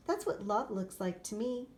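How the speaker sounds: usual speaking voice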